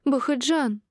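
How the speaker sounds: high-pitched wail of his name; high, falling exclamation; mild yell. A narrator's reading. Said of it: "завопила анестезиолог на всю площадку".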